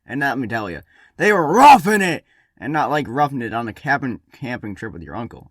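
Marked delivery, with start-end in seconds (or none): 1.2-2.3 high, loud emphatic burst; emphatic shout. Acted. Narrator stressing the ancestors' hard life, emphatic shout.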